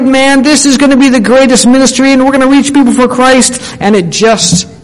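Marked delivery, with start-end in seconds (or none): 0.0-3.6 excited. Retold or imitated. Preacher voicing an eager new volunteer, excited and high. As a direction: bubble over with new-ministry hope. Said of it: "you were really excited".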